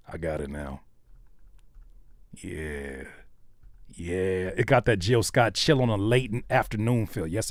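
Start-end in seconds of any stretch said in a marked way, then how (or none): none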